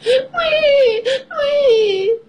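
very high cartoon wail; mock crying. A narrator's reading. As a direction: wail like a little piglet, hammed-up. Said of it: "George starts to cry".

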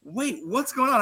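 high, put-on baffled voice; high confused roommate impression. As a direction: play the bewildered birthday boy.

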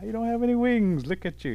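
high, loud incredulous scoff; scoffing stork. Acted. Stork dismisses the turtle's wish to fly, high and incredulous. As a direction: pitch right up in disbelief.

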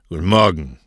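low grunt of a reply; curt, lightly grunted boss's reply; grunted greeting. A narrator's reading. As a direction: grunt "Guten Morgen" back. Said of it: "grunzte Cosme".

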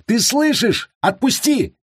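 very high, frantic demand; yelling. A narrator's reading. Prisoner demands his captor listen, yelling. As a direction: keep yelling high to be released. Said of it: "заорал Кристиан".

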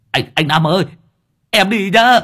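high, cheery helper calling back; young helper calls back, high and bright. A narrator's reading. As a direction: sing out goodbye while speeding off. Helper calls out while riding off.

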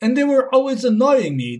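normal talking voice

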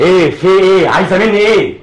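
angry outburst. Acted. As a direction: explode at her for nagging. Brother snaps when pressed.

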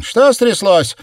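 high, loud call back; alarmed, high-pitched shout; shouted reply. A narrator's reading. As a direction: holler the question back at once. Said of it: "тут же откликнулась она".

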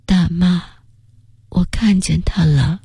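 slow faint whisper. Acted. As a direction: breathe the words out slowly. Dying boy sees his grandmother.

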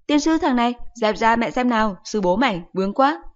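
high, loud exasperated scolding; cursing mother. Acted. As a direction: mom swearing at her son in the way. Mother curses clingy son.

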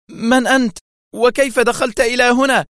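terrified scream. A narrator's reading. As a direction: cry out in alarm at an intruder. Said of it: "قبل أن تصرخ". (صرخة فزع عالية)

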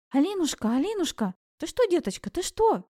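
high, urgent calls of concern; worried, pitch climbing with each phrase; alarmed grandmother. A narrator's reading. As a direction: call out anxiously to a fainting grandchild. Grandmother alarmed as heroine faints.

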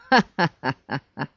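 short, harsh bark of a laugh. Acted. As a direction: let out a barking laugh. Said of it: "Лающий смех вырвался из его горла".

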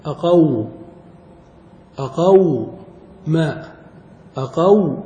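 أقو then ما, each syllable said separately; syllables split apart. Shown as a demonstration. Teacher splitting the word into syllables for the class. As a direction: break أقو from ما so the silent waw is heard. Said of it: "ثم الواو ساكنة".

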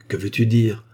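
usual speaking voice

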